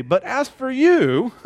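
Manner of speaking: high, loud, stretched-out "you"; emphatic address to Timothy. Acted. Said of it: "So he's telling Timothy".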